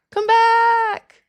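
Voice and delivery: playful high-pitched begging; mock wail. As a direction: cry out to the leaving viewers. Begging viewers not to leave.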